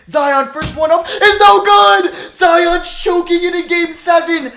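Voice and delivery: real, very loud and high play-by-play yelling; frantic shouting. Real, not acted. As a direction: scream at every twist of the final seconds. Missed free throws, then turnover.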